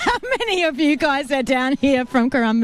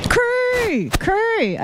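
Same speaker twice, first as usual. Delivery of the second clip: calling out; 11 semitones higher than usual. Real, not acted. Reading rashie, calling out.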